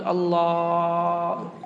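"الله" with the lam held very long on a level, drawn-out note; مد اللام. Shown as a demonstration. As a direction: stretch the "laa" in "الله" for over a second. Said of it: "وتنعقد إن مد اللام". (مدّ لام "الله" مدًّا طويلًا)

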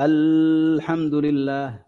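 الحمد لله with a heavy, slow, drawn-out lam of الله; thickened reading. Retold or imitated. Teacher imitating a reciter who thickens الحمد لله. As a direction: slowly darken the lam in لله. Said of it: "يرققها ويغلظها".

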